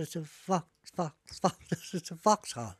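stammering Vox, Vox, Vox before Vauxhall. Retold or imitated. Driver stammering the truck's name to the copper. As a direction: trip over the word Vox before Vauxhall.

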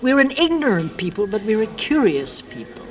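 ordinary delivery

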